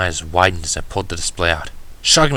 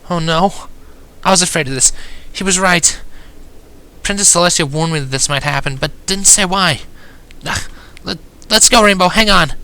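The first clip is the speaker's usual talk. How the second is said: high-pitched, dismayed figure voice; high, anxious character voice building to urgency; worried Twilight; 10 semitones higher than usual. A narrator's reading. Alarmed Twilight voiced in story.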